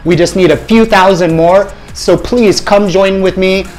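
ordinary talk, nothing marked

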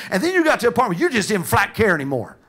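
normal talking voice

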